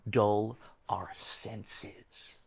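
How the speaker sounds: very slow, whispery menace; ominous. Acted. Conspiracy theorist warning of drugged vegetables, ominous whisper. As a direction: draw each word out in a dark hush.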